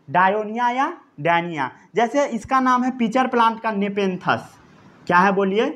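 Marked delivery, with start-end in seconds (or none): none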